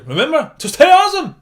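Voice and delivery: loud cheery goodbye, high; excited sign-off. Real, not acted. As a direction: sign off big and bright. Upbeat outro sign-off.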